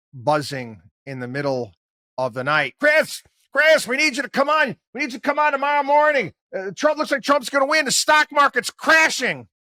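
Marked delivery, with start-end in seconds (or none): 2.8-9.5 high, loud, breathless alarm as if phoning in a panic; hammed-up urgent producer; mock frantic call.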